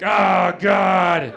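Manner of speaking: loud, high, hammed-up wail; horrified scream. Guy on acid hears his hands are giant, horrified scream. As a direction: scream it in mock terror.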